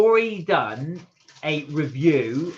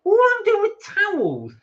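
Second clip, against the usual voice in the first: put-on whiny child; 8 dB louder than usual. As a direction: wail like a child let down by a present. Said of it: "So as a kid".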